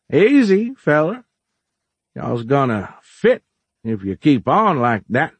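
put-on high, drawling old-timer, calming; slow-rolling folksy shopkeeper. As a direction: ease them down in a slow drawl. Plays Orlin, slow-rolling shopkeeper.

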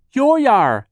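put-on very loud, high toddler yell; piercing one-word outburst; shrieking. A narrator's reading. Sunny tries to stop Charles, shrieking. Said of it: "Sonny shrieked".

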